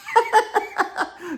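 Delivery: high-pitched burst of real laughter; real high cackling laugh. Real, not acted. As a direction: laugh at your own impression. Laughs at his own gun impression.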